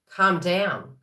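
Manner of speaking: ordinary delivery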